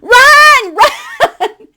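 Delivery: high, loud laughing yell of run; excited strangers yelling from train windows; laughing shout. Retold or imitated. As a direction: shout it through laughter like a crowd urging her on. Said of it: "they're hollering out the windows".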